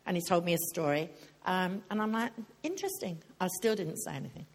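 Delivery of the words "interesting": high, soft "interesting"; noncommittal interest. Retold or imitated. Speaker retelling her reply to the hairdresser's story, mildly intrigued. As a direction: lift it high and quiet, giving nothing away.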